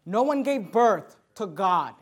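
ordinary talk, nothing marked

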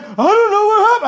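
very high, loud mimicked bewilderment; hammed-up helpless wail of a baffled member; mock cluelessness. Pastor mimicking member after guests walk out, mock cluelessness.

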